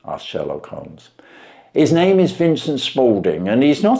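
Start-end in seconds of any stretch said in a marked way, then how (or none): 1.7-4.0 higher, amazed pawnbroker voice; a plain man dumbfounded by the trick; Wilson voiced.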